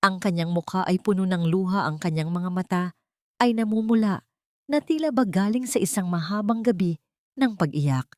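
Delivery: ordinary delivery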